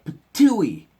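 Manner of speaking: loud high spitting "patooey"; spitting sound. A narrator's reading. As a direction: spit it out hard. Papa spits it out.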